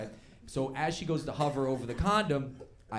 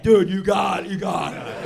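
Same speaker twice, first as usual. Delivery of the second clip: loud, raised-pitch shout imitating excited buddies; buddies egging on; 7 semitones higher, 12 dB louder, slower than usual. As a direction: egg a friend on like a rowdy pack of mates. Buddies egging him on, retold.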